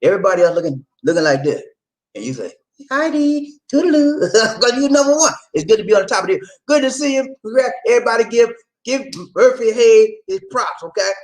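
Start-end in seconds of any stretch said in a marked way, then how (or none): 2.9-5.3 high playful voice. Pretend. Playful greeting to himself.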